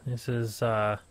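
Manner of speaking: normal talking voice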